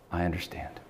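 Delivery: soft, gentle reassurance; softly understanding; gentle welcoming. Retold or imitated. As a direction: say it like a welcome, not a charge. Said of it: "Not in an accusatory way, in a welcoming way".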